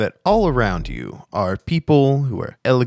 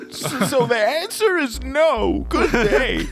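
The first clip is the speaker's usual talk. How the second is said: high falsetto huff; dismissive cab driver; slower than usual. Acted. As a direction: end the talk curtly in a prim, very high voice. Cab driver refuses and leaves.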